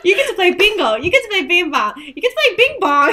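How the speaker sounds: high, loud laughing tease. Real, not acted. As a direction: tease while giggling. Teasing while laughing.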